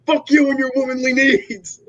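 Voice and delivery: cracking up while hamming; mocking Mark's rant, laughing.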